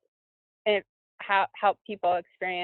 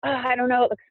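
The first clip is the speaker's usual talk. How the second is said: imitating mild worry, pitched up; nervous fretting; 5 semitones higher than usual. Skier on top of a line recalling her doubts, nervously fretting. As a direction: retell your own pre-run jitters, higher. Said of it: "getting all nervous about it".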